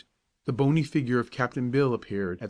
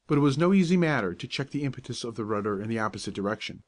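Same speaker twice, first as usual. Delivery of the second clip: usual speaking voice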